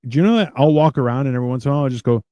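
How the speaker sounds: ordinary talk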